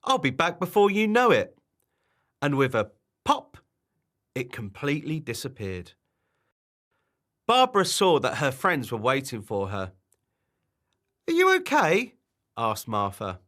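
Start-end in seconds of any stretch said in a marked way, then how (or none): 0.0-1.6 high, loud cheery promise; perky creature voice, pitched up; chirruped. Acted. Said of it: "chirruped the wobbler". 11.3-12.2 high, loud anxious question; high storybook character asking kindly; concerned friend's voice. Acted.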